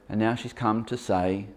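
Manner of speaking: normal talking voice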